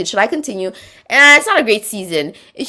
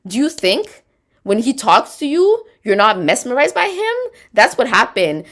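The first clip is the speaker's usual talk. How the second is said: normal talking voice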